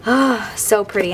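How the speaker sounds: ordinary delivery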